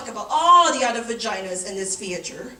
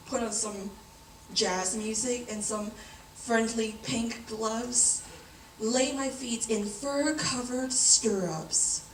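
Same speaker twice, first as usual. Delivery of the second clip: soft, lower, lingering wish list; dreamy longing; 3 semitones lower, 4 dB quieter than usual. Acted. Woman imagining a pampering exam, dreamy longing.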